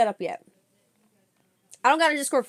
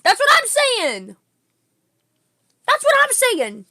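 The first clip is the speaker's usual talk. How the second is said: excited shouting; 10 semitones higher, 7 dB louder than usual. Real, not acted. Excited agreement after scare.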